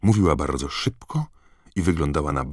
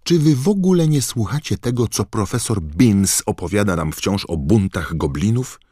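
high, impatient schoolgirl; exasperated girl voice; 7 semitones higher, 6 dB louder than usual. Acted. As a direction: scold friends like a know-it-all. Narrator voices Hermiona, exasperated.

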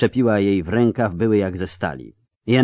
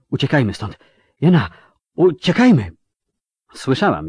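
panicked plea; 4 semitones higher than usual. Acted. Tris begs Yennefer to run, voice cracking with panic. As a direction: beg her to flee in terror. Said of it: "mówiącą takim głosem".

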